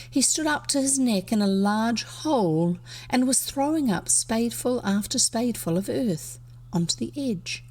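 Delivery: usual speaking voice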